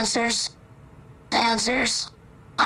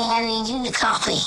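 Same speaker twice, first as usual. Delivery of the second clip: ordinary talk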